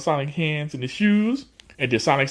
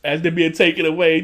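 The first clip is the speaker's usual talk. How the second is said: ordinary talk, nothing marked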